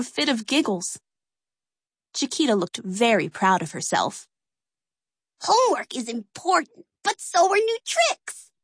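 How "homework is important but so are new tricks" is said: grinning Peggy. Acted. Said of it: "Peggy grinned".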